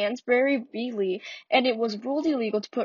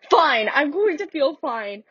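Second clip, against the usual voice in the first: slower, higher, defiant son; Walter's defiant voice; 5 semitones higher, 5 dB louder, slower than usual. Acted. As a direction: insist he'll be fine, a bit raised. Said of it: "I'm not good at accents".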